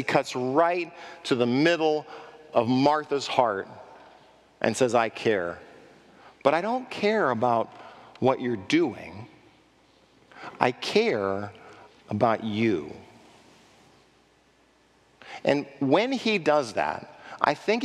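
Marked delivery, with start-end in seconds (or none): 4.9-13.0 gentle reassurance. Acted. Jesus gently answering Martha.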